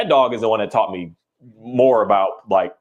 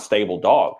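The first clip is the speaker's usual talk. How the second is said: usual speaking voice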